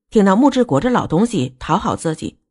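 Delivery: usual speaking voice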